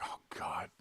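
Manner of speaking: breathy whisper of dismay; whispered dread. Retold or imitated. Whispering to himself as the wife walks up, caught out. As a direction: whisper it like you've been caught out.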